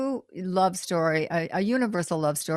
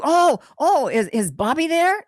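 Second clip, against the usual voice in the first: caller's eager question; 8 semitones higher, 4 dB louder than usual. Retold or imitated. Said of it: "said to you".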